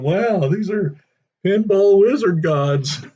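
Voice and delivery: high, slow, awestruck wonder; awed kids. Retold or imitated. Kids marvel at the older pinball players, high and awestruck. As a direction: marvel at it like a wide-eyed kid.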